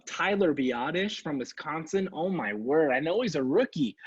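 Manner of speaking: usual speaking voice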